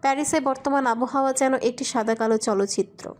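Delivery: ordinary delivery, nothing marked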